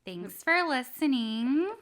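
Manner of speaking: cheery drawn-out host outro; playful sign-off. Real, not acted. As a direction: stretch the words with rising lilt.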